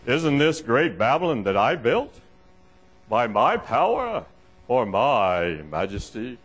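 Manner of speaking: low, slow, pompous monarch; slow, drawn-out royal boasting; boastful king. Acted. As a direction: savour your own greatness in a deep voice. Voices boastful king Nebuchadnezzar.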